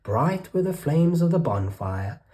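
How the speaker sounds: ordinary delivery, nothing marked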